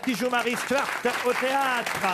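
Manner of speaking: high, sustained game-show confirmation; triumphant announcement. Real, not acted. Host confirms the contestant's winning answer, triumphant announcement.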